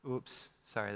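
ordinary delivery

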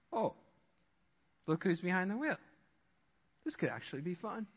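hushed, softened imitation of pleased discovery; mock dawning realization. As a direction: let the realization sink in softly. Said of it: "shifting and transitioning and realizing".

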